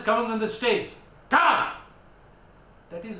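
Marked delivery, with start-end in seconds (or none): 1.3-1.7 higher, louder barked come; a teacher acting out a curt order to come up; sharp mock command. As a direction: snap it like a summons.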